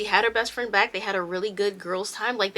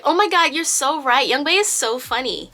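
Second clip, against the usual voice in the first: put-on high, loud gushing Valley Girl; 4 semitones higher, 4 dB louder than usual. Yena gushing about Young Bae's texts, Valley Girl accent. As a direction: imitate a giggly Valley Girl friend. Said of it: "giving her this Valley Girl accent".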